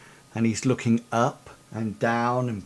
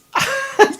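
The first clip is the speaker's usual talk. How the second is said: real laugh; 8 dB louder than usual. Real, not acted. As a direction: burst of high laughter. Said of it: "Oh, bless".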